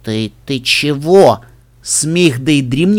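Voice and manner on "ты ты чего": slow, low, indignant question; cross. A narrator's reading. Brother climbs out of the snowdrift, cross. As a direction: demand it crossly, dragging the words. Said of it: "сердито взглянул на сестру".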